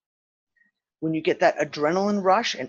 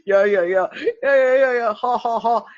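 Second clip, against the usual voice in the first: mock laughing; 5 semitones higher than usual. Joker laughing in the driver's seat, imitated mocking laughter. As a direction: mimic a smug laugh with yeah-yeahs. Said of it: "laughing, going".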